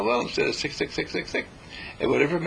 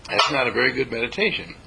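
ordinary delivery

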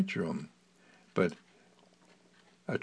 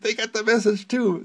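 higher, louder exasperated exclamation; exasperated retort; 10 semitones higher, 5 dB louder than usual. Retold or imitated. Telling someone who repeats it that they already know, exasperated and loud. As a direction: snap it out, fed up. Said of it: "and I say".

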